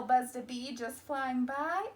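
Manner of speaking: ordinary delivery, nothing marked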